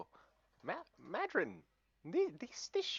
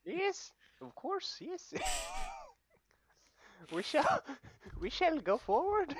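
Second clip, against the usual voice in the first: hammed-up Welsh lilt, high; Welsh accent; 7 semitones higher, slower than usual. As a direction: play the dwarf in a bad Welsh accent. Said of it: "my awful Welsh accent".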